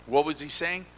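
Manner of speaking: ordinary delivery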